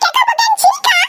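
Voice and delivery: high, shouted complaint; angry shout. Acted. Sister yells at the brother who fooled everyone, a high shout. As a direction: yell it in outrage.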